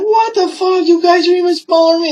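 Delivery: high-pitched imitation of indignant mother; mock outrage. Retelling mother mocking smaller cops, mock outrage. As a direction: squawk it loud and high, mimicking her.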